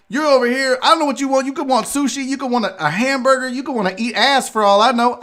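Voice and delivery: high-pitched, exasperated venting at the rude star; snapping rant. Retold or imitated. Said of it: "when I snapped at him".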